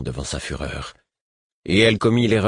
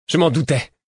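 sour, higher and sharper; bitter; 9 semitones higher, 4 dB louder than usual. Acted. Rebel woman answering the queen, bitter. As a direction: let the bitterness bite. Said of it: "déclara-t-elle avec aigreur".

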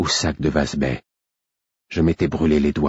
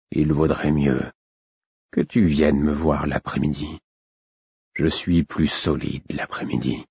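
put-on weak old king; 3 semitones lower, 3 dB quieter than usual. A narrator's reading. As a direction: let the voice sink with fatigue. Exhausted king advises.

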